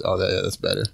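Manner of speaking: ordinary talk, nothing marked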